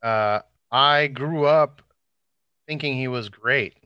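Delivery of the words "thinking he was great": normal talking voice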